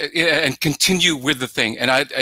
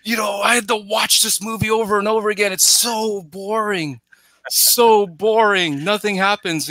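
imitated yelling complaint; 7 semitones higher than usual. Bored guy yelling at the director about the movie, mock-yelled complaint. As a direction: put on a high-pitched, fed-up moviegoer. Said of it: "basically the guy yelled at him".

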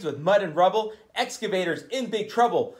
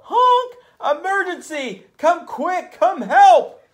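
urgent cry for help; 11 semitones higher, 7 dB louder than usual. Acted. Trucks calling for help.

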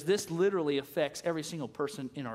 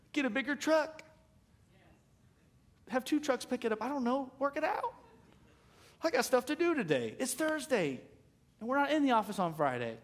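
higher, pleading prayer to get it done; exasperated prayer; 7 semitones higher than usual. Retold or imitated. Pastor prays over the dumpster, high exasperated pleading. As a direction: beg the Lord for a bigger truck, half joking. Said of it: "I argue with the trash company".

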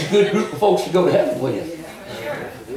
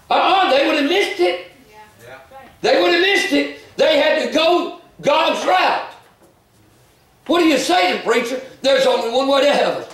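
raised, ringing preacher's call with laughs; fervent preaching; 5 semitones higher than usual. Acted. Preacher rousing the church about God's one way, fervent preaching. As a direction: preach it out to the congregation.

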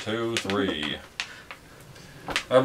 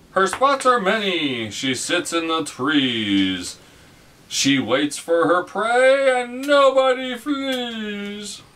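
slow, dramatic riddle reading; grand hollywood dame; 8 semitones higher, much slower than usual. Acted. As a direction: read the riddle like a grand old film star.

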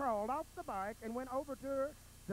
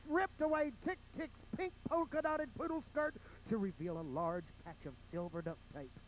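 usual speaking voice

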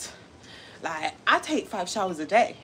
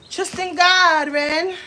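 put-on higher, louder, slow preachy girl voice; imitating that girl; 7 semitones higher, 7 dB louder, slower than usual. Copying the girl who says trust in God, preachy. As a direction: drag it out like the churchy girl. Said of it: "You remind me of that girl".

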